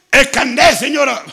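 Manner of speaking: booming, high shout that startles; loud hoarse call. Retold or imitated. Preacher re-enacting warning a lady about her ID card, loud hoarse shout. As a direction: re-enact a forceful call across a bank. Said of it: "La voz ronca, las mujeres la hace temblar".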